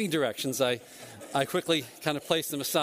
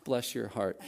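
pitying look; 3 semitones lower, 5 dB quieter than usual. Retold or imitated. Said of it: "it's hard to recreate".

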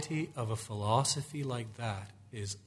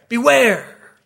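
climactic warning; 11 semitones higher, 17 dB louder than usual. Acted.

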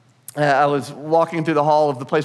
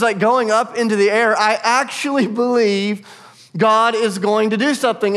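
fervent preaching; 6 semitones higher than usual. Retold or imitated. Paul to his church, fervent raised preaching. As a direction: proclaim it with conviction. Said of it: "he goes".

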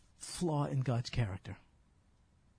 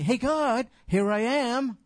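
loud, higher hailing call; 7 semitones higher, 8 dB louder, slower than usual. Retold or imitated. Jeremiah waving at a busy God, loud call. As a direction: wave and shout to be seen. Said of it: "So he's waving to God".

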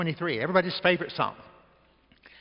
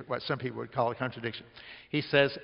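ordinary delivery

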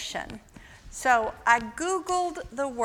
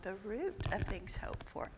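whispering; 14 dB quieter than usual. Real, not acted. Teacher repeats a student's answer.

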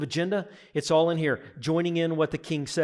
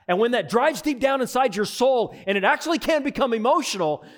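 builds from steady to loud, high proclamation; rising fervent preaching; 4 semitones higher, 6 dB louder than usual. Acted. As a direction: preach it up to a shout of praise. Building to full-force preaching.

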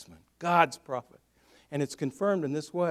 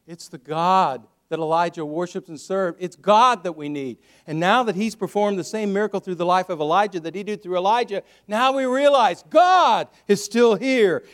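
loud proclamation building to a high peak; full-force preaching; 3 semitones higher, 8 dB louder than usual. Real, not acted.

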